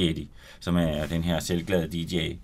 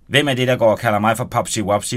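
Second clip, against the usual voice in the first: shouting; 4 semitones higher, 8 dB louder than usual. Acted. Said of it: "råbte hun".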